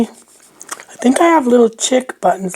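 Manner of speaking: ordinary talk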